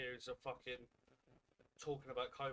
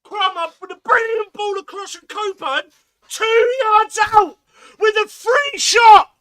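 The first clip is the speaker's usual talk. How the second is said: excited play-by-play; 29 dB louder than usual. Real, not acted. Fan calling the chance live as it happens, screaming excitedly. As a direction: build to an all-out yell as the ball comes in.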